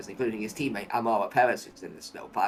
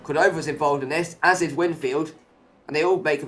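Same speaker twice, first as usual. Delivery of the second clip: higher, louder race call; excited commentary; 4 semitones higher, 6 dB louder than usual. Real, not acted. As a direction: build the excitement of the incident. Contact in the pack.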